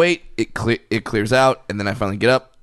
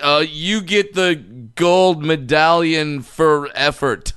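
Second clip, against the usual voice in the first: slow, patronizing coach handing out a pity award; mock coach voice; 5 semitones higher, slower than usual. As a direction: talk down to the kid like a coach faking praise. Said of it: "and you're like".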